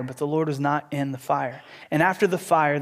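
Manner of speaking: ordinary delivery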